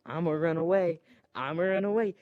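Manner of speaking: high-pitched playful getaway call; mock fleeing cry. Rey as the tie fighter comes at her, mock fleeing cry. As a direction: pitch it up, pretending to flee.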